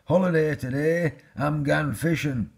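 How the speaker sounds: put-on high, loud boyish exclamation; excited shout. A narrator's reading. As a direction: shout the good news as the boy. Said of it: "he shouted".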